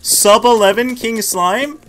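high, loud, slow hopeful ask; excited question. Real, not acted. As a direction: ask it thrilled and drawn out. Hoping for record time.

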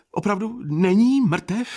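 high, rising, eager disbelief; eager question. A narrator's reading. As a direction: ask urgently, pitch climbing on "není". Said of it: "tázal se dychtivě".

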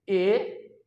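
ए said alone as the sound's name; vowel demo. Shown as a demonstration. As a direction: model ए for pupils. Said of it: "तो ये है ए".

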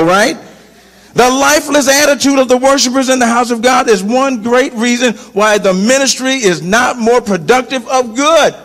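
ordinary delivery

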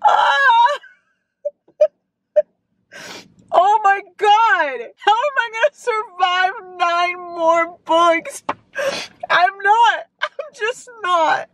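real tearful wail; wailing sob. Real, not acted. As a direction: fall apart in laughing sobs. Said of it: "I'm still crying".

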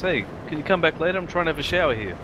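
high, offhand Mini bloke; punchline brush-off. Retold or imitated. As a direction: shoo him away offhandedly. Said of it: "The guy in the Mini Minor says".

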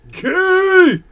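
loud, very high drawn-out shriek; hammed-up heckler's yell, pitch shooting up; mock yelling. Streamer imitating everyone in chat yelling out, mock shriek. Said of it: "Everyone yelling out".

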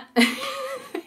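quick high-pitched giggle; high laugh. Real, not acted. Storyteller amused at her own tale, high giggle. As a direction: break into a short high laugh.

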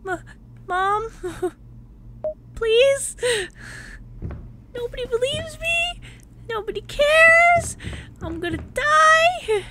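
high, whimpering plea to mom; half-playful terrified wail, drawn out on the last words; panicked whimpering. Acted. Player voicing a frightened character calling for mom, high panicked wail.

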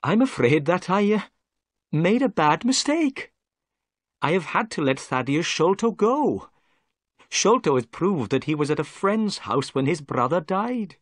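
a proud officer owning up to a mistake; humbled inspector. A narrator's reading.

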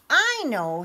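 high and loud, bursting with an idea; eager idea. A narrator's reading. Little Red telling friends her plan, eager. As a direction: pop out the idea brightly. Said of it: "said Little Red, clapping her hands".

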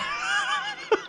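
high shrieking nervous laugh; panicked laugh. Real, not acted. Gamer being chased, high shrieking nervous laugh. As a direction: laugh out of sheer terror.

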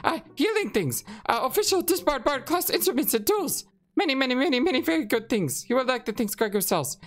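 high, stammering rat shopkeeper. Acted. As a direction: squeak it out like a nervous rat. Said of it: "rat voice".

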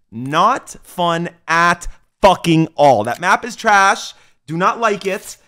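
loud slow fed-up shouting; angry rant. Real, not acted. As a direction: spit out the bad rating. Rating the match angrily.

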